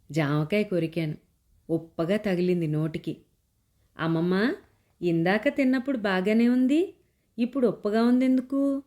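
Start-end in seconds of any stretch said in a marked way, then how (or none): 4.0-8.8 put-on higher, questioning little girl's voice; child's raised, wondering question; curious child asking. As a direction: ask grandma like a puzzled small child. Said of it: "అడిగాను".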